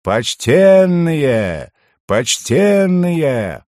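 high, very slow, drawn-out cry; loud wail. A narrator's reading. Youth to the party across a big market, wailing cry. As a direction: holler across a crowd, stretching the vowels. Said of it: "разнёсся вопль".

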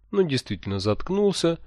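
ordinary delivery

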